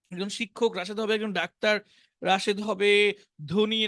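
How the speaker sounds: ordinary talk, nothing marked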